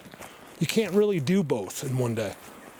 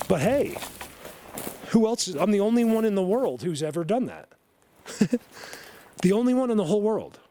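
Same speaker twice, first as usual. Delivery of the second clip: excited boast; 7 semitones higher than usual. Real, not acted. Bragging about unique achievement.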